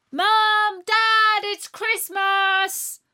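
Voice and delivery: high, loud, drawn-out call; excited shout. A narrator's reading. Natalie running down to Mum and Dad, shouting. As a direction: call out joyfully through the house. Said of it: "she shouted".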